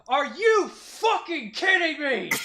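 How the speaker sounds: high, slow, drawn-out shout; outraged distant yell. Acted. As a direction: bellow in disbelief from across the crowd. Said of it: "you just hear in the distance".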